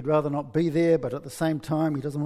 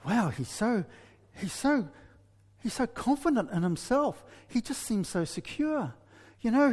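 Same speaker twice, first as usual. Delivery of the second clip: put-on smitten girl thinking; 5 semitones higher than usual. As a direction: gush like a girl falling for him. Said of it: "She's thinking something like this".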